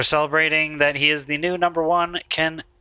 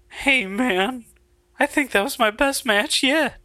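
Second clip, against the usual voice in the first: put-on higher, soft grateful kid voice; soft young wrestler; 9 semitones higher than usual. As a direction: look up and thank him softly. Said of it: "Alabaster does softly look up".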